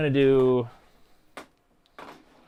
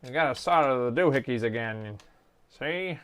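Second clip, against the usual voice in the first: lightly voiced goofy tutor; a hint of a jokey character voice; silly put-on voice. Explaining the soldering to viewers in a mild goofy voice. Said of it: "I don't know why I'm talking like this".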